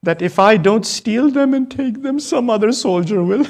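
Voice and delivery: high, loud, sobbing through the words; real grief cracking the voice; voice breaking into tears. Real, not acted. Preacher breaks down retelling.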